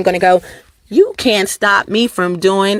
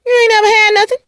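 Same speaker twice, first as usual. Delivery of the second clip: high crying delivery; 11 semitones higher, much faster than usual. Shown as a demonstration. Acting out the line for viewers, higher and tearful. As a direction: wail it like it wounds. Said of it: "Let me say it like that".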